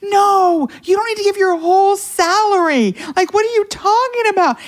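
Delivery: very high-pitched, exasperated outburst of protest; retold screaming. Mom protesting her daughter giving away her salary, screaming. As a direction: replay a scream of disbelief, voice shot way up. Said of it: "I literally am screaming".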